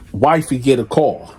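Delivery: ordinary talk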